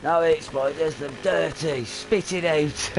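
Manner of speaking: high, loud imitation of a little girl; imitated telling-off, stretched at the end; scolding child. Daughter telling dad off afterwards, imitated high scolding. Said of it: "she's going to me after".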